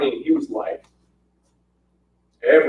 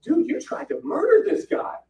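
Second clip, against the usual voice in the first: mock scolding; 9 semitones higher than usual. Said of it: "I'm like".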